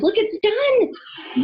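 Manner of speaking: high, slow, drawn-out proud call. Acted. Wolf calling friends to see his finished cabin, proud. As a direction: show it off to everyone. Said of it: "he called to his friends".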